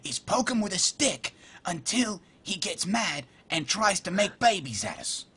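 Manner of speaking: a playful Australian wildlife presenter, creeping up on a crab; Australian accent. Pretend. Gamer friends asked for Australian, mock Australian wildlife host. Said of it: "Somebody said do Australian".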